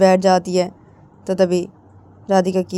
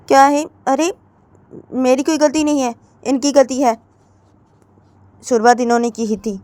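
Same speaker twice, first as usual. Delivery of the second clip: higher voice, protesting; it's not my fault, pitched up; defensive young wife; 6 semitones higher, 3 dB louder than usual. Acted. Radhika protesting to her mother-in-law, higher and defensive.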